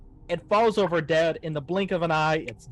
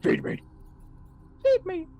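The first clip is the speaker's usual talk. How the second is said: high eerie creature begging; a hint of a hungry monster. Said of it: "looks at you and says".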